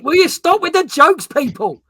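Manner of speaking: high, loud playful scolding; mock exasperation. Real, not acted. Host to joking viewers, mock exasperation. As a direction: scold them in mock despair.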